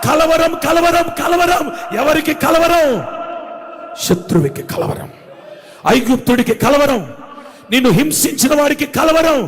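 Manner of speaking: loud, high fervent preaching cry; preaching at full force. Acted. Preacher to his congregation, shouting with full fervour. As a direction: rouse the congregation with rising shouts.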